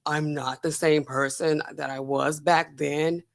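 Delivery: low, slow, soft put-on deep voice; Holmes baritone. Contrasting Holmes then and now, low slow baritone. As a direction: lightly mimic her old forced baritone. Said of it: "she's not talking like".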